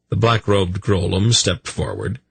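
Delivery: ordinary delivery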